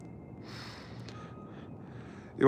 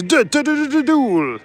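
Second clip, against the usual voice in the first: anime duel call; 11 semitones higher than usual. Pretend. Yu-Gi-Oh duel catchphrase.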